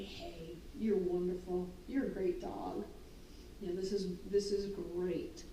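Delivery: soft, low calming praise; soothing praise. Retold or imitated. Trainer calming a jumping dog, soft low praise. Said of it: "I get down and I say".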